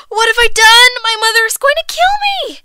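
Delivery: high, loud cry of alarm; panicked girl. A narrator's reading. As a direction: wail in storybook panic. Girl sees herself colored all over.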